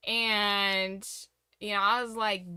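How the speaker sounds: usual speaking voice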